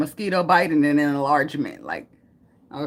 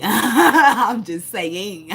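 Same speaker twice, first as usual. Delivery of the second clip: high, loud words through real laughter; laughing; 5 semitones higher, 7 dB louder, much slower than usual. Real, not acted. Woman laughing at her own blunt joke, real laughter.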